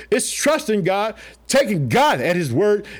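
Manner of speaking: usual speaking voice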